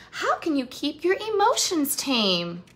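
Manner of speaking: ordinary delivery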